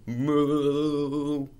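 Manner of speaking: long, jiggling cow moo. Acted. Reader acts out a jiggling cow, jiggling moo. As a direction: shake the moo like jelly.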